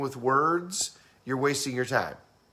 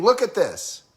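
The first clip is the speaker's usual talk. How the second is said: much higher, mock urgent demand; stressed visual person; 7 semitones higher, 3 dB louder than usual. Visual person urging auditory person, mock stressed and insistent. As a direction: insist on it with a jump in pitch. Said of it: "visual person is gonna be saying".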